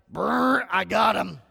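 hammed-up gotcha voice; mock gleeful smite. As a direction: play a gleeful God hitting the button. God mock-smiting a sinner.

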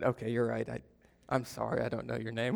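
low, very soft confession; sheepish admission. Retold or imitated. Admitting to the relative he forgot her name, sheepish. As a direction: own up quietly, deflated.

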